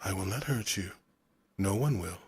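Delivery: put-on hushed shh, then a soft, rhythmic accented voice; a hint of a deep, musical foreign voice. A narrator's reading. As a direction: soothe a frightened, feverish boy. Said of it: "The voice was deep, the accent was thick and round".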